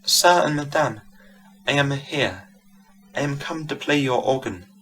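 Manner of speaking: put-on light soft Italian accent, gentle; a hint of an Italian lilt, softly; softly spoken with a faint Italian accent. A narrator's reading. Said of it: "with a soft Italian accent".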